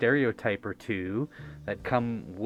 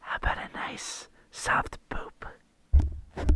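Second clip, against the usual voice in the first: whispering; 3 semitones lower than usual. Acted. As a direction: breathe it like an ASMR sponsor read. Sponsor read as ASMR.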